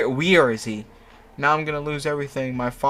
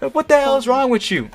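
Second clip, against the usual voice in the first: high, loud furious scream; hammed-up scream of rage; 13 semitones higher, 7 dB louder than usual. Narrator screaming at the laughing Murdoch, high and loud. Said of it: "I scream".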